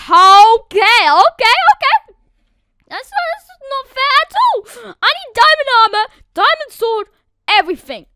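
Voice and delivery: high, loud flustered okays; rising alarm; panicked. Real, not acted. Gamer panicking about the coming bed destruction, flustered.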